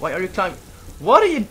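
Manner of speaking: sharp, high, loud shout at a teammate; exasperated yell. Real, not acted. Yelling at Kelly for climbing again, loud. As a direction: yell in disbelief at a reckless teammate.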